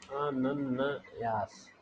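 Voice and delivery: very slow, each syllable of अनन्यास drawn out: अ-नन्-यास; slow spelling demo. Shown as a demonstration. Teacher slowly spelling a word for students.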